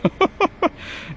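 high, thin snigger; a quick scornful snicker. Acted. Guard scoffing at Slipher's question with a snigger. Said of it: "The guard sniggered".

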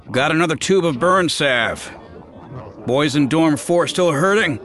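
high loud shout over the shoulder. Acted. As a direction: call across the room, loud. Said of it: "then shouted over his shoulder".

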